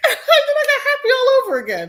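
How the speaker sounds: high, loud, laughing delivery; gleeful laughing. Real, not acted. Telling of hearing her abuser is dead, laughing gleefully. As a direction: squeal it through gleeful laughter. Laughing over news of his death.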